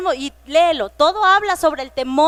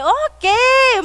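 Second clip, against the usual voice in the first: high, loud startled ok; mock surprised ok; 9 semitones higher, 5 dB louder than usual. Teacher reacting to a child's shocking answer, surprised. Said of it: "Y les dije".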